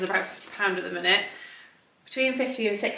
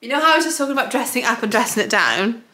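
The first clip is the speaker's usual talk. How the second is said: ordinary talk, nothing marked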